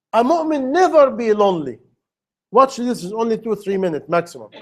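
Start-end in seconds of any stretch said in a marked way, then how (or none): none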